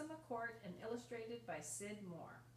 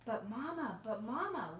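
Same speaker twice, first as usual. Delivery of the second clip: put-on pleading child; 7 dB louder than usual. A narrator's reading. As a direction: call out to mama like an eager kid. Child pleads with mother in story.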